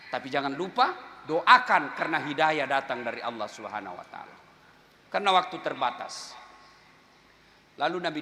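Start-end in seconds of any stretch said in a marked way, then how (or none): none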